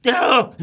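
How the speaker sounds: very high, loud startled squeal; loud high squeak of alarm. Acted. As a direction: jump at the tickle. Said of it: "squeaked Mister Grumpy in alarm".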